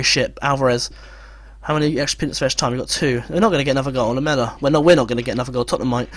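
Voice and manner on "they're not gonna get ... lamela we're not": normal talking voice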